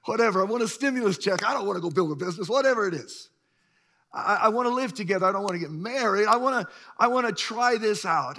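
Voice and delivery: hammed-up dismissive protest; mock noncommittal excuses. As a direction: brush off commitment in a hurry. Mimics a culture avoiding commitment.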